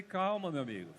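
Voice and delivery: low, soft and calming, to a gunman; calm soothing tone. Retold or imitated. Pastor calming the armed robber, low and soothing. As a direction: talk him down gently, no panic. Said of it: "com esse tom de voz".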